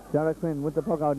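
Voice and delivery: normal talking voice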